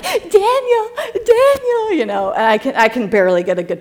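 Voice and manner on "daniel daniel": high sing-song calling of Daniel; calling child high. Retold or imitated. Mom photographer calling her son for a picture, high sing-song. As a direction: coax a child to look at the camera, pitched way up. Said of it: "I'm like".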